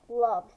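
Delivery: Loved said alone, firm and falling; stress on loved. A narrator's reading. Narrator stressing how Mister Z felt, Loved landed firmly. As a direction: lean hard on "Loved.".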